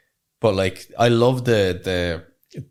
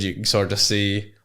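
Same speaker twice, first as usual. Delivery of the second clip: normal talking voice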